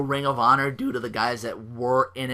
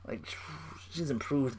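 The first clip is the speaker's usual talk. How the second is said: whispering; 10 dB quieter than usual. Real, not acted. Whispered aside.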